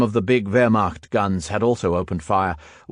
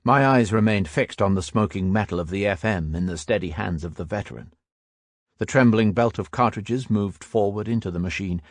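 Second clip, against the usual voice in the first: ordinary delivery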